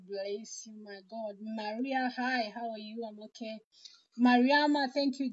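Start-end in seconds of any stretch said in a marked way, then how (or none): none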